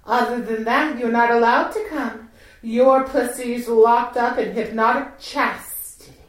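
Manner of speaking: ordinary talk, nothing marked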